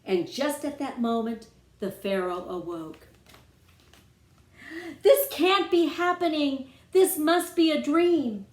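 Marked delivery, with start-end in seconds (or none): 5.0-8.4 high and loud, in a frantic panic; the disbelieving cry of a king waking to frogs; panicked scream. Acted. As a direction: Pharaoh wakes and shrieks high in panic. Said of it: "Pharaoh began to scream".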